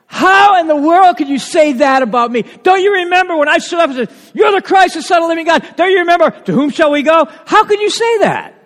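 loud, high, hurt protest; indignant shout of disbelief; offended outburst. Retold or imitated. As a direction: cry out as if wounded by the accusation. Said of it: "He's offended".

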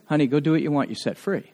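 put-on soft, gentle words to a freed girl; a touch of tender kindness; tender release. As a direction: say it softly, like setting her free. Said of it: "I said".

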